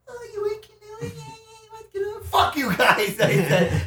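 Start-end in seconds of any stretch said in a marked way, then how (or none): 0.1-2.2 very high, singsong mimicry; mocking echo. Paddler parroting the housemates' question, mocking. 2.2-3.2 loud, high retold yell; angry outburst. Paddler telling off the friends at the house, angry outburst.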